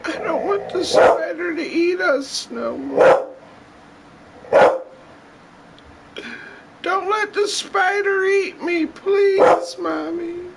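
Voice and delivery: put-on high panicked childlike screaming; shaking off a spider. Panicked screaming about spider in hair.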